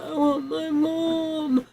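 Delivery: high, soft, slow whimpering plea; retold plaintive wail. Injured friend asks for her mother, retold plaintive wail. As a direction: whimper it weakly, drawn out.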